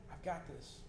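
quiet, settled assurance; reassuring. Retold or imitated. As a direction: drop to a soft voice. God reassuring us, voiced.

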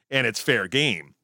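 ordinary talk, nothing marked

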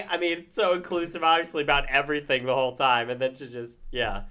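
ordinary delivery

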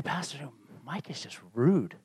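low, very soft voice, imitating a complainer; hushed complaint. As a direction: quietly tell on someone to the pastor. Congregants complain privately to pastor.

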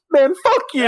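high-pitched tearful defiance, played for laughs; mock crying. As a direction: imitate a beaten man wailing as he flees. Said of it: "crying and running away".

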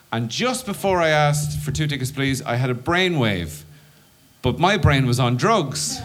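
usual speaking voice

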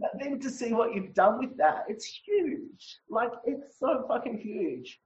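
tearful, breaking voice, slowing down; crying. Real, not acted. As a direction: cry it out while speaking. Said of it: "I'll just cry it out 'cause that's how I roll".

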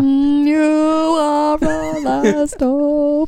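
high, very slow alien monotone; drawn-out flat alien plea; Thermian voice. Retold or imitated. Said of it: "in a very monotone voice".

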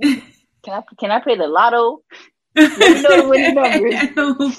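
joking begging for lotto numbers; laughing joke. Retold or imitated. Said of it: "And I'm like".

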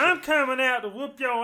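high, loud pro wrestler trash talk; wrestling promo shout. Acted. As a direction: roar it like a heroic rallying cry. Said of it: "Wrestling promo while being sprayed with water".

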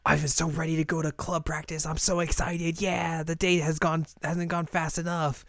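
high, breathless-with-eagerness schoolgirl imitation; eager newcomer. Azusa raring for club practice, imitated with high eagerness. Acting out eager new member.

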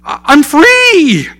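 high, loud, slow cry of joy; a freed debtor crying out his joy, high and loud; overjoyed. Acted. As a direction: let the freedom burst out of you.

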